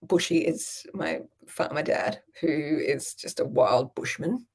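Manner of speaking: usual speaking voice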